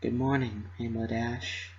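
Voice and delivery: soft, hushed good morning; barely above a breath, so as not to wake anyone; gentle whisper at dawn; whispered greeting. A narrator's reading. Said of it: "she whispered".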